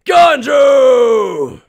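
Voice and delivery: proud shout. Acted. Ganju shouting his name to a Soul Reaper. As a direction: bellow his own name. Introducing himself with a yell.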